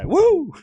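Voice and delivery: high, loud "woo"; excited whoop. Real, not acted. Streamer tells chat what's coming, then whoops on Wu's name.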